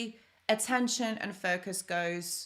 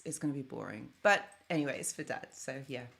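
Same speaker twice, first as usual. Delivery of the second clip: deadpan mutter; 6 semitones lower, 3 dB quieter, faster than usual. Real, not acted. Dreading jazz dinner.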